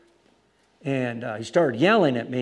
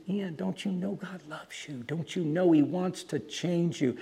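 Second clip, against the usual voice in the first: soft, higher earnest appeal; hushed retold entreaty; gentle plea; 4 semitones higher, 6 dB quieter than usual. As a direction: plead tenderly with someone up close.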